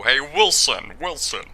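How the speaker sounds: a touch of gruff House calling out; mock House voice greeting his buddy; House impression. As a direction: call your friend like Dr. House would. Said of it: "I'll be doing my house impression".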